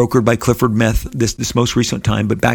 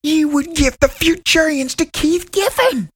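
slow, much higher mock Southern drawl imitating Dave; Southern twang; slower than usual. Dave angrily phoning Rob about Giffen, imitated in a Southern twang. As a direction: stretch it out in a put-on Southern accent, imitating an angry caller. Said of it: "he had a little bit of a Southern twang".

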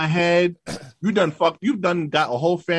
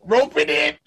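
much higher gleeful cry; excited squeal; 11 semitones higher than usual. Real, not acted. Cheering his friend on, excited high squeal. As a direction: squeal it out in delight. Teasing exclamation.

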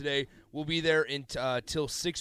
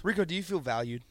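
normal talking voice

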